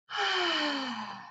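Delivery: long audible sigh out; big breath out. Shown as a demonstration. Teacher demos a big exhale for children. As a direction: breathe out slowly for the kids. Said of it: "Take another big breath".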